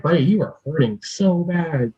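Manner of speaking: real emotion rising in the voice; getting emotional. Real, not acted. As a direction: speak to your younger self, welling up. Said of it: "I'm getting emotional just thinking about it".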